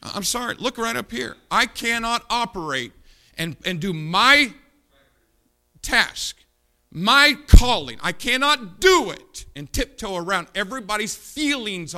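loud, high fiery preaching; preaching at full force. Real, not acted. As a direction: thunder it from the pulpit.

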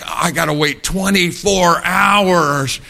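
mock-whiny boy unable to wait; impatient kid.

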